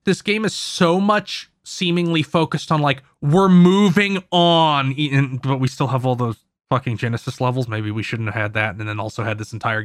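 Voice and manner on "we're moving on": loud, drawn-out proclamation; slow, loud mock slogan; mock declaration. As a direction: announce it big, stretching the words. Acting out the game's message.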